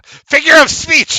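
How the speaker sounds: loud high snapped yell. Acted. Merchant yells back at the mocking woman. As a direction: bark it back defensively. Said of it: "he says, yelling".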